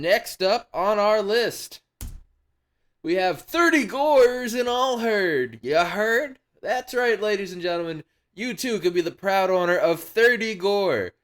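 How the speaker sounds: hyped-up host voice; announcer. Pretend. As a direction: present it like a showman revealing a prize.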